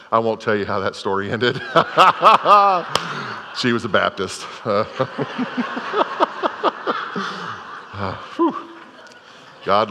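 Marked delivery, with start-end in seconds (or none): 1.7-3.5 a higher, louder burst of real laughter. Real, not acted. Preacher laughing to the congregation at his story, real laugh. 4.5-7.9 soft, high trailing laugh; real laugh. Real, not acted. Preacher still laughing after the Baptist joke, soft laugh.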